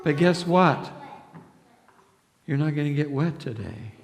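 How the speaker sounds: plain reading voice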